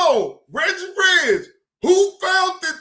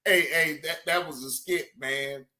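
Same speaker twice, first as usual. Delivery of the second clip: much lower, pleading imitation; imitating Cam Lo; 10 semitones lower, 5 dB quieter than usual. Imitating Cam Lo pleading with Johnny Blaze. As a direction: plead low like Cam Lo begging. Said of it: "He said".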